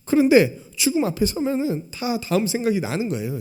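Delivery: usual speaking voice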